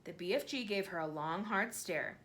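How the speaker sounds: usual speaking voice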